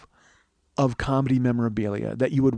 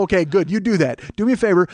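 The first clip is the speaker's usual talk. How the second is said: ordinary talk, nothing marked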